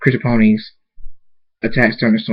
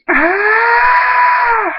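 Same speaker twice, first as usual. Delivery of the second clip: long, sustained high-pitched scream; 3 dB louder than usual. A narrator's reading. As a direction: hold a very high acted scream. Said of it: "Thunderstorm screams".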